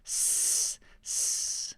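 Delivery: a whispered, drawn-out hiss "S" like Osho; Osho's hissed S. Shown as a demonstration. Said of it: "he does a lot of S's".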